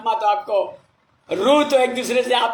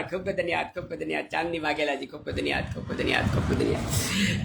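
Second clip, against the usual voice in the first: fading thanks; 6 semitones lower, 9 dB quieter, faster than usual. Real, not acted. Thanks repeated, dying away.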